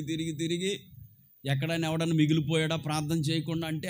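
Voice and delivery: ordinary talk, nothing marked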